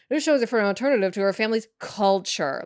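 a light mock read of "This shows it for an alternative to her family's culture"; mock wordy version. Shown as a demonstration. Mocking her own clunky sentence to the cohost, lightly voiced. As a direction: toss off the long draft with a dismissive lilt. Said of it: "Not like".

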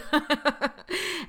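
high bright laugh; burst of laughter. Real, not acted. Laughing about the Uber driver.

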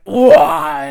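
loud amazed whoa; excited whoa. Retold or imitated. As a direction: burst out in wonder. Superhero power switching on.

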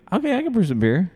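pitched well up, a playful put-on; jokey high voice for the moment of decision; mock eureka voice. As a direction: act out the sudden realization, voice up high. Host acting out guest's thought.

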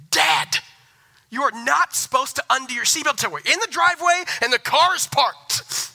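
loud, high-pitched mock scolding; imitated child yelling. Daughter catches her father unbuckled in the driveway, mock yelling. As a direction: yell like an outraged kid telling off Dad. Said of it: "my daughter starts yelling at me".

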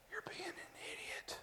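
soft breathy rebuke, no voice at all; Holy Spirit whisper. Retold or imitated. Holy Spirit tells the preacher off, in a whisper. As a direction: whisper the rebuke like a conscience in your ear. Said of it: "it goes".

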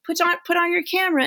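mock teacher prodding her Zoom class; teacher urging students. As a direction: nudge the kids to turn cameras on, fast and high. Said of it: "and it's like".

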